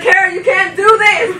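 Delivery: loud, high retold scolding; exasperated boss. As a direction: imitate a boss pleading at full volume.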